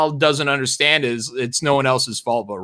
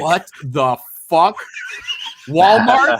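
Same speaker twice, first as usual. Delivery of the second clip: baffled customer; 4 semitones higher, 4 dB louder, slower than usual. Retold or imitated. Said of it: "And they're like".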